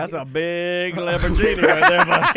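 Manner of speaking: laughing. Acted. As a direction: crack up mid-tease. Teasing line broken by laughter.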